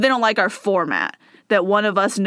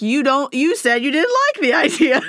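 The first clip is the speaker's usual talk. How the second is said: higher-pitched, incredulous comic protest; mock indignation; 6 semitones higher, 3 dB louder than usual. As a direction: protest the contradiction, laughing at it. Mock-arguing with a listener's review.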